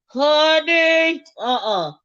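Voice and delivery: normal talking voice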